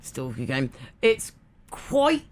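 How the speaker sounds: normal talking voice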